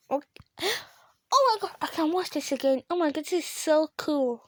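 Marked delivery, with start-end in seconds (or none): none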